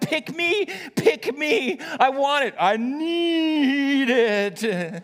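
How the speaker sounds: put-on high, loud child voice, stretching the last words slowly; children clamouring for the bill, high and loud; eager kids calling. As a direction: plead like a kid who just has to have it. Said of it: "the whole place starts shouting".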